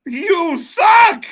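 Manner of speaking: high, loud crowd-style heckle; jeering crowd chant; mock heckle shout. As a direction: yell it like a fan in the arena.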